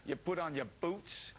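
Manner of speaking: lightly imitated New York firefighter accent, slow; a New York firefighter, drawn-out New York vowels; thick New York accent. Imitating a New York firefighter's thick New York accent. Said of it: "he introduces it in the thick accent".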